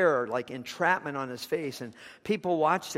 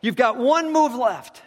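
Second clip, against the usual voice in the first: loud, high, slow urgent call; shout; 8 semitones higher, 7 dB louder, slower than usual. Retold or imitated. Chess master shouting to the young man. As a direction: cry out urgently, slowly. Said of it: "He yelled out".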